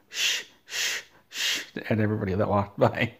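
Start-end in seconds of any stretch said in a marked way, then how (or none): 0.1-1.6 long unvoiced shushing hiss. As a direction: shush everyone walking past, breathy and hushed. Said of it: "It was Bernie Sanders going".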